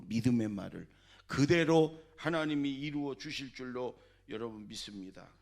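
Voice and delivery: normal talking voice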